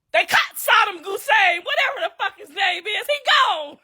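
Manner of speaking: high, loud mock yell of the news; mock shout. Yelling the news at her husband after he hit her. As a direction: announce it to him as a blaring joke. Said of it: "I said".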